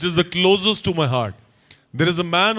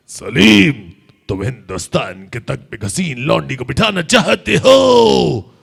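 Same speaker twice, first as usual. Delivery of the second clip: louder, lower angry roar; booming emperor shout; 5 semitones lower, 6 dB louder than usual. Retold or imitated. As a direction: bellow like Akbar in rage. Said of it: "गैस". (गरजती, गुस्से भरी आवाज़)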